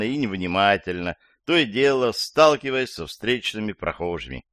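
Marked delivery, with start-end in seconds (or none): none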